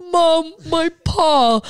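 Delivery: high, very slow pitiful whine; whiny hurt dog. Retold or imitated. Dramatic dog showing Mom her paw, mock whine. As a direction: whine it out like a wounded drama queen. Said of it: "like, dying ... she's like".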